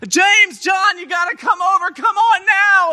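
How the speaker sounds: high, loud urgent holler; screaming for help. Acted. Simon calling the other boat over to help, screaming. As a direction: yell across the water. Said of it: "they start screaming for the other boat".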